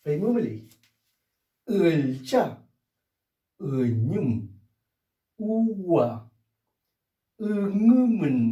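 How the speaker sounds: each word set off by pauses and said clearly; word drill. Shown as a demonstration. Teacher repeating drill words, careful pronunciation. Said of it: "repeats drill words".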